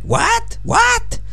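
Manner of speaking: put-on very high, squawky old man "What? What?". Shown as a demonstration. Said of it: "I taught him how to say things like".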